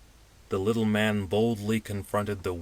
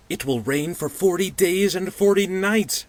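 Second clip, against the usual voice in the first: much higher, louder moaning whine; 11 semitones higher, 7 dB louder than usual. Acted. Pudgy man moaning to his companions, high and loud. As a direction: bemoan the rain like a soggy wretch. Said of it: "said the pudgy man moaningly".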